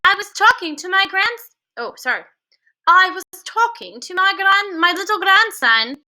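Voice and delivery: a hint of a chatty grandmother; grandmother's voice. A narrator's reading. Grandmother calling to the witch about her grandson.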